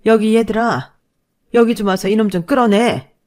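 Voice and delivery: loud call across the hall. Acted. Mother shouting for nephews to drag husband out. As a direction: shout for the boys to come. Mother calls cousins to help.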